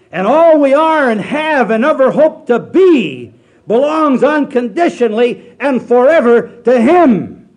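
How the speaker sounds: loud, high, slow pulpit proclamation; full-force revival preacher; preaching at full force. Acted. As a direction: thunder the scripture out slowly.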